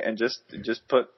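normal talking voice

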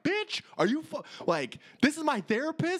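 incredulous high yelp at the phone; high exasperated outburst. Retold or imitated. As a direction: blurt it out in shocked disbelief. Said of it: "I'm like".

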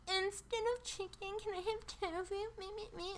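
tiny high-pitched mock whine; very high, small mocking voice; annoying customer. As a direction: squeak it softly like a fussy orderer. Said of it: "you don't have to be like".

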